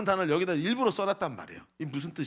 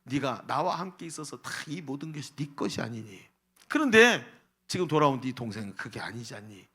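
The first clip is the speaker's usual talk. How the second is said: gentle father; 5 semitones lower than usual. Retold or imitated. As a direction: coax the son tenderly. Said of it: "이러면서 말씀하시면서".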